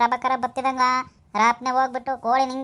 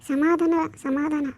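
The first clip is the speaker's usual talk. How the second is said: slow calming; 3 semitones higher, much slower than usual. Acted. Calming an impatient friend.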